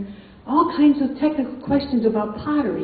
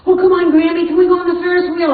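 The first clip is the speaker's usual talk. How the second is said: put-on higher, louder and fast, like an eager kid; begging child; 6 semitones higher, 4 dB louder, faster than usual. Grandchild begging Grammy to ride the Ferris wheel, eager pleading.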